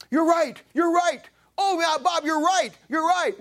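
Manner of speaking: high, eager woman's voice insisting; excited lady. Retold or imitated. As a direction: gush it like a thrilled churchgoer. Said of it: "and she says".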